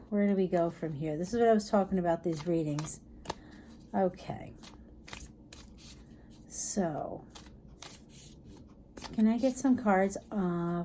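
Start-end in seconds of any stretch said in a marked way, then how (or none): none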